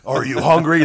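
loud, high hype shout; shouted pitch line. Pretend. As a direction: yell it like a hammy ad.

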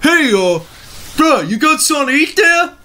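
high exaggerated Brooklyn accent; hammed-up Brooklyn tough guy. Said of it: "Stereotypical Brooklyn accent".

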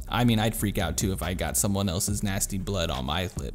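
ordinary delivery

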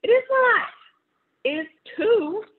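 high, loud it-is-not squabble; two kids yelling over each other; childish shouting match. Acted. Ruthie and Martin fight over the camera, high and shouting. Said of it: "shouted Ruthie".

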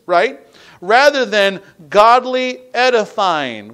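forceful preaching. Acted. Preacher reading scripture to the congregation, forceful preaching. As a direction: drive the scripture home to the congregation.

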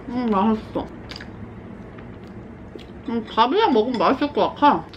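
talking while chewing food; words muffled by food; mouth full, chewing. Real, not acted.

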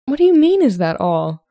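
high, loud burst of indignation; indignant. A narrator's reading. Emmeline rounds on Solomon for dismissing her, indignantly. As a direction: throw the question back, outraged. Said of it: "Emmeline asked indignantly".

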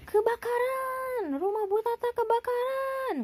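high, slow panicked call; alarmed cry of fire. A narrator's reading. Pak Badru cries fire at Bu Tata's house.

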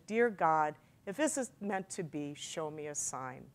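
very soft, retold prayer; silent prayer. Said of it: "I said a silent prayer".

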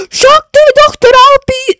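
high, loud shocked squeal; high surprised voice. Acted. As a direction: squeak it out in disbelief. Voicing character recognizing doctor.

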